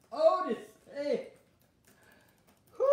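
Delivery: normal talking voice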